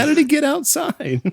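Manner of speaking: a hint of mock confusion; baffled. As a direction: sound completely baffled. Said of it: "It's like".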